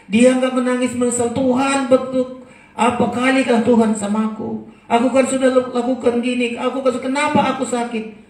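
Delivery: high, loud protesting prayer; complaining cry. Acted. Hezekiah not complaining to God, loud protest. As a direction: demand an answer from God.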